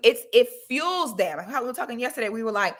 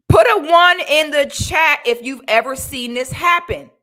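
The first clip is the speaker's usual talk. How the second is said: calling out; 6 semitones higher, 9 dB louder than usual. Real, not acted. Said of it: "calling out to the chat".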